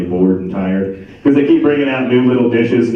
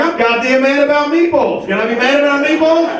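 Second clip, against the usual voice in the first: outraged husband shouting over lost meatballs; furious rant. Retold or imitated. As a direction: rant at the wife, pitch climbing with each line. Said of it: "I was like".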